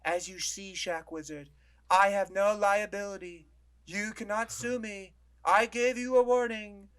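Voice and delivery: high, slow, pompous corporate voice; YouTube Man voice. Retold or imitated. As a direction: play a smug tech mouthpiece. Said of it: "let me do YouTube Man's voice".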